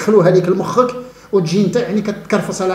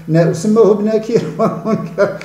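laughs through the names, slower and higher; can't keep a straight face saying them; amused mocking laugh; 5 semitones higher, slower than usual. Real, not acted.

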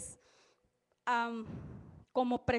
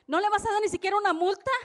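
high, loud indignant yell; retold shout; 7 semitones higher, 6 dB louder than usual. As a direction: shout it like the outraged accuser. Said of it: "grita el acusador".

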